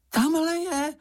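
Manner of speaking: sudden very high squeal; Čenich crying out. A narrator's reading. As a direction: squeak out an excited discovery. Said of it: "vykřikl najednou Čenich". (vzrušený pisklavý výkřik)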